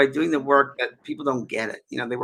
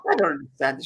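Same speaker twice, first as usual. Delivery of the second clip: put-on Scottish accent. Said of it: "I'm trying to my Scottish accent".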